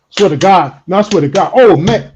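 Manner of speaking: loud, worked-up impression of an emphatic talker; loud swearing-to-God talker. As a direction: make some noise, pitch climbing. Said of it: "people who talk like this".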